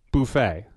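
"buffet" with an oo in the first syllable; "buffet" as boo-fay. Shown as a demonstration. Host asks about others' usage, "buffet" as boo-fay. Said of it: "do you know people who say".

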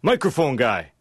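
a restrained yell, like hailing someone across a lot; mock shout. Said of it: "points at me and shouts".

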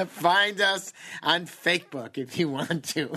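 slow, still laughing. Real, not acted. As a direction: grin through the laughter. Still laughing after joke.

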